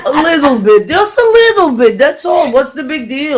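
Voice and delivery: put-on high, dismissive Russian accent; comic Russian-accented protest; mock Russian accent. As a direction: shrug it off as a Russian, laughing. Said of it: "Russian dog version of Flowers".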